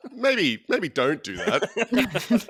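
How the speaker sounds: high, loud, through real laughter; laughing objection. Real, not acted. Host objects to dog chew-toy joke, laughing.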